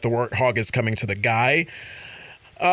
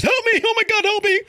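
mock panic. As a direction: squeal for help like the panicked guy. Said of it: "And then, of course".

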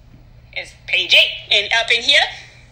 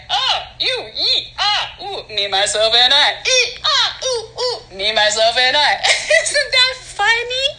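high giggling fit; hysterical laugh; 4 semitones higher, slower than usual. Acted. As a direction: giggle helplessly between words.

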